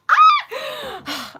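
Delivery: high, loud squeal of joy; excited squeal. Real, not acted. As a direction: let out a burst of delight. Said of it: "I'm so excited".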